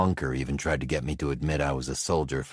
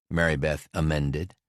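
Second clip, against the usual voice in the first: ordinary delivery, nothing marked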